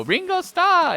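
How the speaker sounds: high squealing call; imitating young Japanese women; excited fans. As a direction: point and squeal like a fan. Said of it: "young Japanese women where they point at him and they go".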